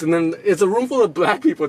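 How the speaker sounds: ordinary talk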